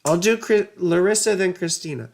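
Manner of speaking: ordinary talk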